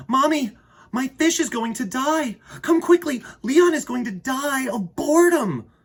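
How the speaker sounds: put-on high, loud panicked little boy; urgent child. Arthur begging his mommy to come quickly, high and frantic. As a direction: cry out for mommy like a frantic kid.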